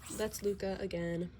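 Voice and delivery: ordinary talk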